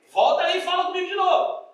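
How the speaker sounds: a husband loudly ordering God to come back; mock indignant demand. Preacher voicing an indignant husband, loud and demanding.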